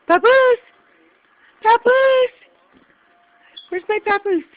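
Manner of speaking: high, loud pet-name call. Real, not acted. Calling the baby loudly.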